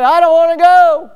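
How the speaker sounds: put-on high, loud childish whine; whiny child. Preacher mocking reluctant Christians when the Lord comes, loud high whine. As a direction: whine like a kid dragged from a game.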